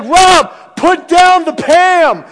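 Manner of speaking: high, loud, slow warning; alarmed shout. Retold or imitated. Yelling at Rob wielding the spray torch, slow alarmed shout. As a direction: yell it out slowly, stretching each word.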